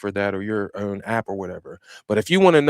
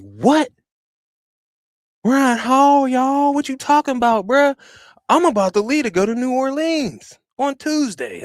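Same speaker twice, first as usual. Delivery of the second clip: high disbelief; 10 semitones higher than usual. Retold or imitated. Reacting to the weatherman's forecast, high and disbelieving. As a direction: squeal in shocked protest at the forecast. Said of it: "I was like".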